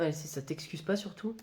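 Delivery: grumbled aside to herself; muttered aside. Acted. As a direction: mutter it under your breath. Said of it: "Tu m'as parlé?".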